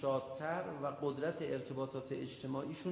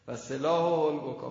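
slow, louder Arabic recitation of و سلاح البکا; reciting the prayer; 8 dB louder than usual. Retold or imitated. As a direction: intone و سلاح البکا with pleading. (و سلاح البکا را آهسته و با التماس بخوان)

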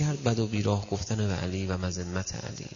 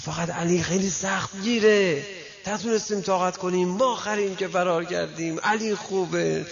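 raised plea; 6 semitones higher than usual. Retold or imitated. Friend defending Ali to the host, raised pleading voice.

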